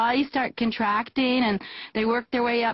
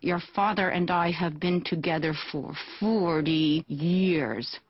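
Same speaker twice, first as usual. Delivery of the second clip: lower, softer, slow strained words; mom through clenched jaw; 7 semitones lower, 3 dB quieter than usual. Retold or imitated. Mom with resentful clenched jaw addresses her child. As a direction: squeeze it out through a locked jaw. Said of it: "my mom could barely get the words out".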